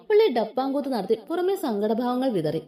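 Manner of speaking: plain reading voice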